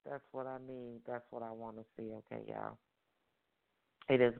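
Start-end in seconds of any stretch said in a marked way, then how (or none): 0.1-2.8 softer, lower breathy aside; whisper. Real, not acted. Host quietly telling listeners she's pleased, whispered. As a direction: drop to a hushed whisper. Hushed aside after whoops.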